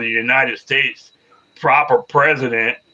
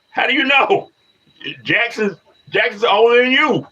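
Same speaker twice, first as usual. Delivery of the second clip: incredulous retort; 10 semitones higher than usual. Real, not acted. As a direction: fire back in disbelief, voice jumping up. Arguing back at a chat commenter.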